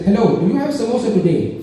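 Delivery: put-on Indian accent on the phone. Caller asking the grocer for samosa, put-on Indian accent. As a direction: ask lightly in a mock Indian accent. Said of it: "I started in an Indian accent".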